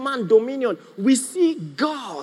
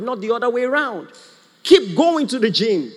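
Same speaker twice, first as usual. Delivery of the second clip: usual speaking voice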